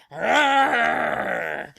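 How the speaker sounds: long cross-bear roar; angry bear roar. Acted. Papa Bear's roar.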